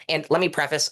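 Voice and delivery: normal talking voice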